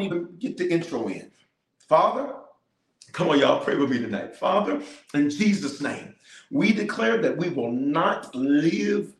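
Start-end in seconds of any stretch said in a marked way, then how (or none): none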